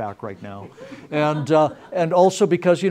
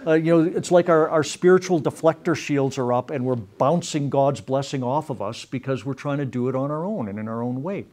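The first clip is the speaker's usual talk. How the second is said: ordinary talk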